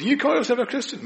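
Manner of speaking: high sneering taunt; mocking accuser. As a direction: taunt him like the enemy. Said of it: "the enemy comes and goes".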